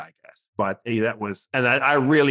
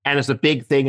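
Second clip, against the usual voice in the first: ordinary delivery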